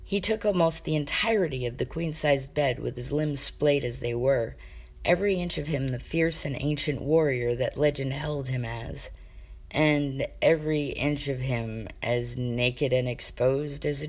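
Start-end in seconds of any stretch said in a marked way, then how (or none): none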